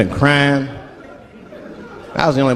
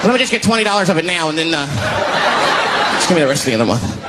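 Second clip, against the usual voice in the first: higher, begging landlord, rushing at the end; pushy landlord voice; 4 semitones higher, faster than usual. Retold or imitated. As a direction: plead for the rent like a jittery addict. Voicing crack-addicted landlord.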